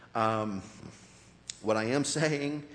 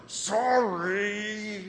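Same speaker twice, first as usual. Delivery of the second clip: put-on higher, drawn-out childish sorry; mock insincere apology; 9 semitones higher, 5 dB louder than usual. Grown-up still apologizing like a forced kid, mock insincere. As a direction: stretch it out like a kid who doesn't mean it. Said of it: "we're still the ones going".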